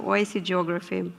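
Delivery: ordinary delivery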